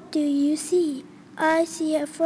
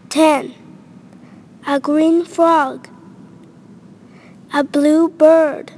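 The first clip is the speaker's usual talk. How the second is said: put-on reading to a toddler; 7 dB louder than usual. Loud vocabulary reading for children.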